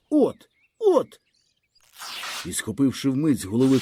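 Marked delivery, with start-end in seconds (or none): 0.1-1.2 bitter cry. A narrator's reading. Boy's bitter outburst.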